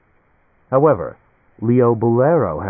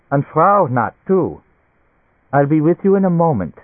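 higher-pitched, brisk German physician; German doctor; 7 semitones higher, 4 dB louder than usual. A narrator's reading. Doctor asks the couple to wait, German doctor voice. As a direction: play a busy German doctor. Doctor greets arriving patients.

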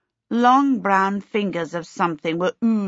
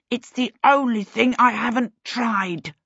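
snarling retort; 5 semitones higher than usual. A narrator's reading. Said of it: "Peter snarled at her".

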